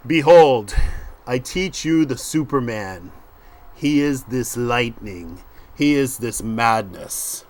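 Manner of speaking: slow, weighty quotation, dropping at the attribution; dramatic epigraph reading. Acted. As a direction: proclaim it slowly, opening with a loud burst. Reads the epigraph aloud.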